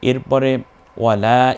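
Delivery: ordinary talk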